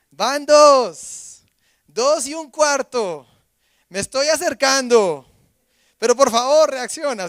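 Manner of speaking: conteo lento y fuerte, cada vez más alto y agudo; exasperated counting. Retold or imitated. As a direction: cuenta despacio, subiendo la presión como madre impaciente. Wife counting to warn child. (madre contando lento y fuerte, cada vez más alta)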